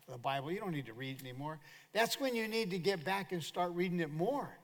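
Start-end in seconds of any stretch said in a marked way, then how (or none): 0.1-1.6 lightly voiced Satan, quick and softer; Satan's coaxing. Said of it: "Satan doesn't want it to happen. So he said".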